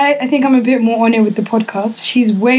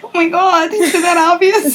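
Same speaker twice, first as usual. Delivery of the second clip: high, slowed mock gasp; high mock shock; 8 semitones higher than usual. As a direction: act caught out, voice up. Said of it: "I was like".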